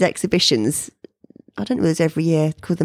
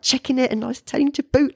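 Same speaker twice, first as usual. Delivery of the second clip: ordinary delivery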